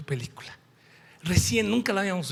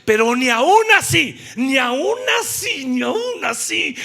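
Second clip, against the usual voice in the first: loud, slowing "ni aún así" again and again; emphatic repetition; 6 semitones higher, 9 dB louder than usual. Acted. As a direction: hammer the reproach home. Repetición enfática del reproche. (reproche enfático y fuerte)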